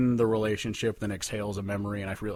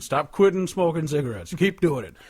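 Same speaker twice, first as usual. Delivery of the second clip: a higher, louder joking voice for the silly advice; mock advice; 6 semitones higher, 5 dB louder than usual. Joking about the lyric to his friend, mock-encouraging tone. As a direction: play the bad advice as a cheeky joke.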